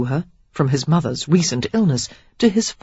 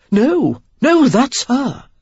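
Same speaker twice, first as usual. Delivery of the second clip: high, insistent, slow; insisting; 8 semitones higher, 6 dB louder, much slower than usual. A narrator's reading. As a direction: insist it's really her. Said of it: "the first insisted".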